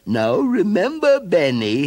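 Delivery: high, slow warning mother's voice; stern mother. A narrator's reading. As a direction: remind the child firmly. Mother warns her son.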